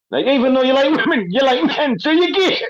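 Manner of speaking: high-pitched, amused kid imitation; little gangster rapper. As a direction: explain it like a little rapper, voice shooting up. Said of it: "the little, little gangster rapper dude".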